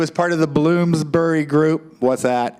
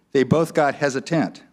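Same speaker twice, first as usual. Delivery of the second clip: ordinary talk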